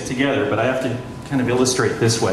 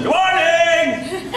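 high, slow, booming mock hello; premier's big greeting; much slower than usual. Radio host mimicking the premier bursting onto the air.

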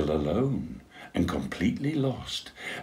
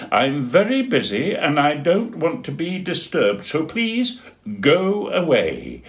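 stamp your little foot; huffy penguin shutting them out; cross penguin; 10 semitones higher, 5 dB louder than usual. Acted. Dudley sends them away.

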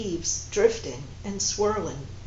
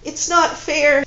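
higher, louder, slow tearful protest; weeping; 7 semitones higher, 8 dB louder, slower than usual. Acted. Woman protesting her fate to her lover, slow and tearful. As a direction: push the words out through tears. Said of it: "she wept".

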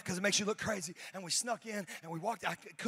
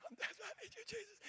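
desperate woman; 13 semitones higher, 14 dB quieter, faster than usual. Acted.

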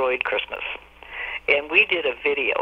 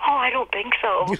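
breezy fib; 10 semitones higher than usual. Retold or imitated. Friend fibbing about drool.

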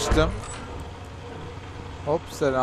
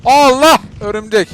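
startled; 8 semitones higher, 9 dB louder, slower than usual. Real, not acted. Sudden spider scare.

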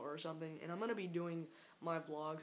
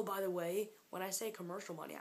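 ordinary talk

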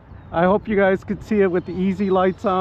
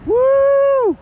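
a loud, high whoop of delight; excited whoop; 5 dB louder than usual. Real, not acted. Pilot cheering his plane's pass, a loud high whoop. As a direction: cheer the jet as it screams past.

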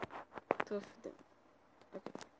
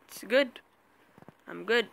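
ordinary delivery

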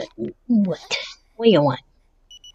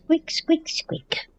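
voiced, high squeak-squeak imitation fading to a whisper; high toy squeaks; 9 semitones higher, 3 dB quieter, much slower than usual. Showing viewers the dog's toy, high squeaks.

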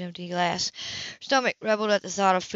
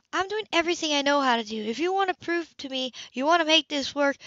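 higher, controlled and insistent; firm older sister; 8 semitones higher than usual. Acted. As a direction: lay out the terms steadily. Sybil's voice, pitched higher.

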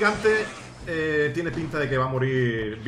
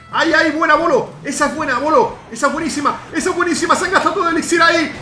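high, loud, breathless-with-hope praise; excited cheering; 11 semitones higher, 9 dB louder than usual. Real, not acted. Player cheering Bolo's move, excited shouting. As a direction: shout encouragement at full tilt.

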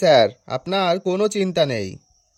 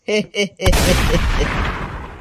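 giggling cackle, trailing off; laughing. Acted. Riddler laughs at own silly answer, laughing. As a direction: burst of laughter after a joke.

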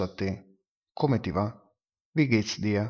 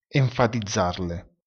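usual speaking voice

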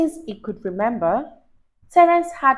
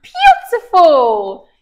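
laughing praise; 4 semitones higher, 10 dB louder, much slower than usual. Real, not acted. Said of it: "praising child, laughing".

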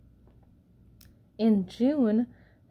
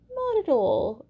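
high bright chorus of pebbles; 7 semitones higher, 6 dB louder than usual. Acted. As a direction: answer high and cheerful. Pebbles answer.

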